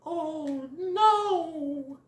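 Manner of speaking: high, drawn-out dismay; Thumper. Acted. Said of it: "Thumper goes".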